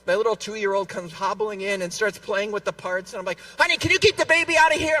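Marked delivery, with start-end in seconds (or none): 3.6-5.0 fast, loud, higher snap at the wife; rushed, exasperated call to get the baby out; stressed plea. Retold or imitated. Stressed husband snapping at his wife over the baby. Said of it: "I'm a little stressed out".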